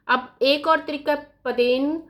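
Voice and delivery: usual speaking voice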